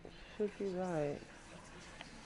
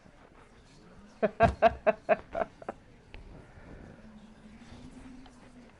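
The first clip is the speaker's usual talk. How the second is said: bursting out laughing, high; real laugh at himself; loud laugh; 8 semitones higher, 6 dB louder than usual. Real, not acted. Laughs at own mistake.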